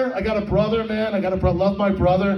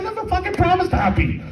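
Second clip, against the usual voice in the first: worked-up rant; 7 semitones higher than usual. Retold or imitated. Ranting back at wife.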